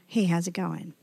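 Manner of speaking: low, soft, offhand hey how's it going; tossed off like a brush-off; casual passing greeting. Shown as a demonstration. Preacher contrasting a careless greeting, said low and offhand. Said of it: "That's not like a".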